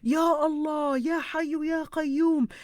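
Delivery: high, drawn-out supplication; fervent prayer. Retold or imitated. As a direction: plead to God aloud, slowly and insistently. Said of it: "I was saying".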